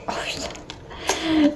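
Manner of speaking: breathy whisper, drawn out slowly; whispered curse. Real, not acted. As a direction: curse it under your breath. Swears under breath at box.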